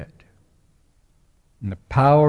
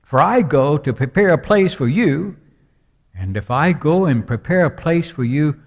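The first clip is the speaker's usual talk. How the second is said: higher and louder, swelling cadence; preaching at full force; 4 semitones higher, 4 dB louder than usual. Acted. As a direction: proclaim it from the pulpit. Preacher quotes John fourteen fervently.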